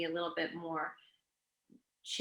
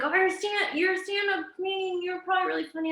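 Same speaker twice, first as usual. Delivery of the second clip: high, bright-eyed imitation of a fan; mock fan; 10 semitones higher, faster than usual. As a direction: lightly play an eager fan gushing. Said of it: "people will be like".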